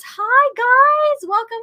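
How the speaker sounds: high, loud squealed hello; real delighted call-out; excited greeting. Real, not acted. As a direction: greet arriving friends way up high. Greeting viewers arriving in stream.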